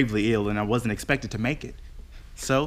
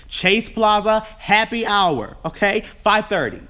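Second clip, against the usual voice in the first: bossy; 10 semitones higher, 5 dB louder, slower than usual. Acted. Bossy friend giving orders.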